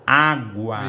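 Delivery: "água" stretched, A-GUA with audible U; U pronunciado. Shown as a demonstration. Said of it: "eu ouço o som do U". ("água" destacando bem o U)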